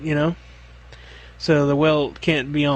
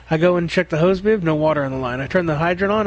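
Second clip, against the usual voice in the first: ordinary talk, nothing marked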